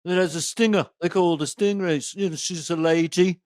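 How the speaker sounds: put-on lilting Beatle's Liverpool accent; [name]. Retelling a stingray news story, in Beatle accent. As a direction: put on a Beatle accent, tune lifting as if asking. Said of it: "as a Beatle would say it".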